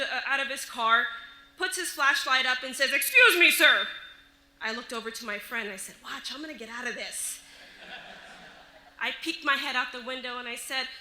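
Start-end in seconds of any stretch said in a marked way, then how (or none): 2.9-3.9 loud, higher officer's call; police officer. Retold or imitated. Officer addressing a pulled-over driver, loud official call. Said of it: "The officer comes out". 6.0-7.4 softer, confiding retold aside; sly aside. Driver confiding in her friend, quiet sly aside.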